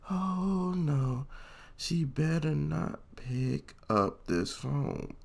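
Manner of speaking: high, hushed, slow pleading to oneself; acted dread. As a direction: draw it out softly as the phone rings. Dreading mom answering the school's call.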